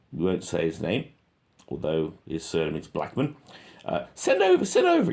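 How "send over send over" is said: much higher, louder mock radio talk; squeaky radio voice. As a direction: mimic a soldier on the radio, squeaky. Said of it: "he's speaking on the radio".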